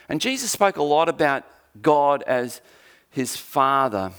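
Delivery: normal talking voice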